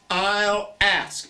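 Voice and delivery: usual speaking voice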